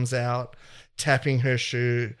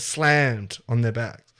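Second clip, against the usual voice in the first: usual speaking voice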